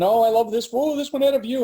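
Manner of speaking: bright gushing rise on each line; mock thrilled house hunter; imitating excited wife. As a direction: gush over the view like she did. Wife excited over farm listings.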